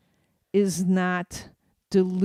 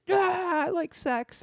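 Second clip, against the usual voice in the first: higher, lightly voiced dumb crowd reply; dopey crowd answer; 11 semitones higher, slower than usual. Audience answering in unison, lightly voiced dopey. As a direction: answer like a simple audience member. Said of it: "uniform, like".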